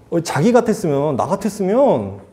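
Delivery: ordinary talk, nothing marked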